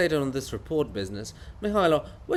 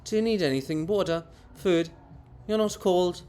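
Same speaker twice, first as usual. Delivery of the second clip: normal talking voice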